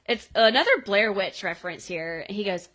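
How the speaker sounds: ordinary delivery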